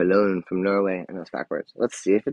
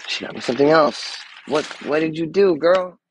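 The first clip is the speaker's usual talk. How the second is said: higher and louder, delighted shock; surprised squeal; 7 semitones higher, 5 dB louder than usual. Real, not acted. Finding another gift from her best friend, delighted high outcry.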